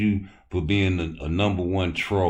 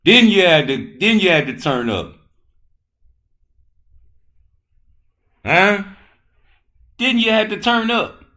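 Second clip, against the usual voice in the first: higher and louder with each repeat, hyped; excited taunting shout; 12 semitones higher, 8 dB louder than usual. Real, not acted. Streamer hyping up a boxer who came back from two eight counts, building shout. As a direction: keep pushing it up, crowing at him.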